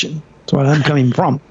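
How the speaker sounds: normal talking voice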